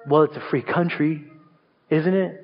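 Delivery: low, lazy comeback; sassy sibling retort. Retold or imitated. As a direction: toss it back with a shrug. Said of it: "And they would say".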